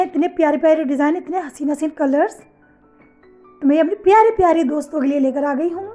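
ordinary delivery

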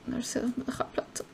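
whispered to herself. Real, not acted. Puzzling over the lost plate, whispered. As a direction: hush it, voiceless. Muttered aside.

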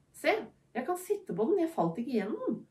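high, eager and delighted; excited. Acted. Tycho shows his sister he can sit on it, high excited voice. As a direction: bubble over with the discovery. Tycho thrilled sitting on hologram.

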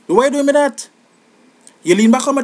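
exasperated complaint. Pretend. Complaining to a dancer leaning back.

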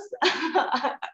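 short, light real chuckle; amused laugh. Real, not acted. Showing the audience her printed photos, amused laugh.

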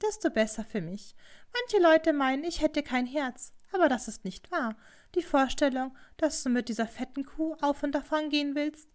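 lightly voiced haughty rival; scornful Hatsumomo. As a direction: taunt a servant girl coldly.

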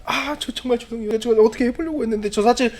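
building to high, frantic begging; acted desperate pleading. Cornered man confessing everything, begging for his life.

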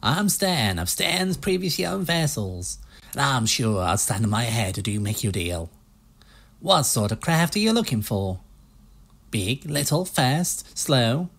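lightly put-on low American used-ship salesman accent. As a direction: pitch the boats like a pushy American car salesman. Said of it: "stereotypical US low male salesman accent".

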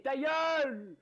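loud, high yell from the back; heckler's shout. Acted. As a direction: act out a heckler yelling across a room. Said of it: "il y a un mec qui crie". (cri aigu et fort d'un chahuteur)

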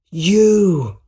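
high, loud exclamation; shocked recognition. A narrator's reading. Peter turning to find the Green Goblin, shocked. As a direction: blurt it out on seeing an enemy.

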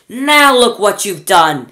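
loud, slow, high reproach; exasperated scolding. Acted. Mr Wonka scolding Grandma Josephine, loud and slow. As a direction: drag out the blame, loud.

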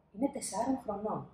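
plain reading voice

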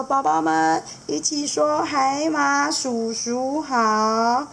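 very slow, coaxing; catfish dad prompting kids. Acted. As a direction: spell it out slowly for the little ones. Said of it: "他就跟他的宝宝们说".

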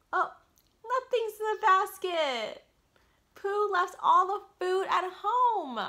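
high, loud, slow mock shock; hammed-up storyteller dismay; surprised dismay. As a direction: gasp at the empty basket. Revealing empty basket to child.

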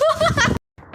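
very high, loud squealing laugh; shrieking laugh. Real, not acted. Friend cracks up while recording, high shrieking laugh. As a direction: burst into a piercing shriek of laughter. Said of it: "หัวเราะยังไงให้ได้เสียงแบบนั้นวะ".

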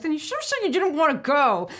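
exasperated retort. Retold or imitated. Acting out retort to whining boyfriend.

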